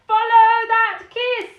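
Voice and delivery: high, slow squawk; squawking king. A narrator's reading. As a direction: squawk it out, shrill and loud. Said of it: "he squawked".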